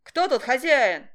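loud, slow demanding call; shouting. A narrator's reading. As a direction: demand the owner loudly. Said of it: "кричал он".

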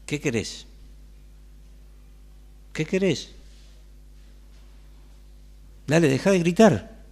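losing patience step by step; growing impatience. Acted. Jesus pressed, rising impatience. (impaciencia creciente)

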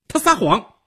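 loud, high-pitched accusation; sharp angry shout. A narrator's reading. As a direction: cry out the accusation in anger. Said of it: "她喝道". (愤怒地高声喝道)

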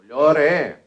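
acted shouted advice; calling out. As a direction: shout it back from the shore. Passer-by shouts advice back.

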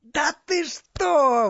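very high, loud and drawn-out; delighted exclamation. A narrator's reading. Pharaoh amused by the priest's news, exclaiming in delight. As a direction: exclaim with long-stretched delight. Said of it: "воскликнул он обрадованно".

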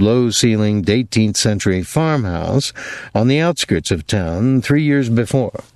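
ordinary delivery, nothing marked

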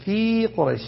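"في" as a separate, long-drawn fii before Quraysh; layman's misreading. Shown as a demonstration. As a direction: stretch the vowel of "في" as if it were the preposition. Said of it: "هذا غلط".

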